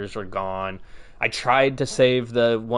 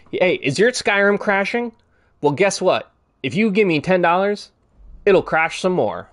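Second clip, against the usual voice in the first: high, bright salesman parody; mock sales pitch; 8 semitones higher, 3 dB louder than usual. Bethesda selling crashing mods, hammed-up salesman voice. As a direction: pitch it like a cheesy commercial.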